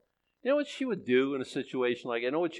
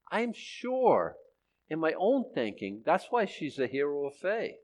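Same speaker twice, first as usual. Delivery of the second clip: ordinary delivery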